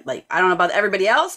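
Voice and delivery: normal talking voice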